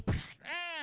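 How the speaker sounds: short, high-pitched mock newborn wail; comic imitation of a baby's first cry; imitated baby cry. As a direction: squeal like a just-born baby. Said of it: "you hear the baby cry".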